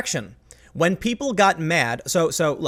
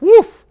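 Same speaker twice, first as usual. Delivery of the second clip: exasperated woof; 12 dB louder than usual. Real, not acted. Reacting to the awful plot.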